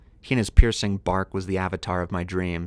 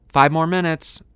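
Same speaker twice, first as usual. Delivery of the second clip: put-on higher, louder kid's call for more time; yelled plea; 9 semitones higher, 6 dB louder than usual. A narrator's reading. Narrator as child answering parents' dinner call, yelled. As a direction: call it out to parents indoors, raised and drawn up high. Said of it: "I yelled".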